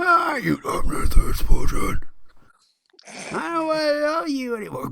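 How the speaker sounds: high, loud screaming rant; mock shrieking rocker. Nathan Explosion yelling at the worker, high mock scream. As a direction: shriek it like a metal singer. Parodying a shouting rock star.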